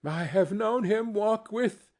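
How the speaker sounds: high, brave cheer that breaks off; cheerful, then faltering. A narrator's reading. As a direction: start cheerfully, then falter mid-sentence. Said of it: "steady, cheerful voice that only faltered once".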